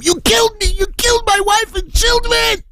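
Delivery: very high, anguished screaming; piercing high-pitched outcry; acted scream. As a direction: scream the accusation in grief. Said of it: "again started to scream".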